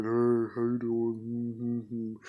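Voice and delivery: low, slow, sagging mopey greeting; mopey mood. Shown as a demonstration. Vlogger recalling his mopey past days, low and slow. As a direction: drag it out like you can't be bothered. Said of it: "in a, uh, mopey mood".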